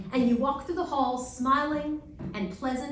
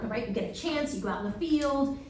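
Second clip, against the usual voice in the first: ordinary talk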